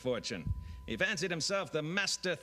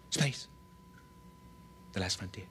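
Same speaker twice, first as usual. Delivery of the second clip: William Shatner; 6 semitones lower, 7 dB quieter than usual. Retold or imitated. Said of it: "William Shatner".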